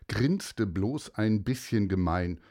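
ordinary delivery

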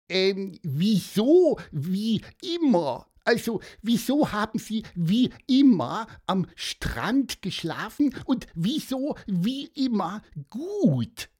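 hohe, bohrende Vogelstimme; neugierig. Acted. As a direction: neugierig nachhaken als kleiner Vogel. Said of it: "natürlich neugierig wie immer". (Pieper fragt den Mann neugierig, hoch)

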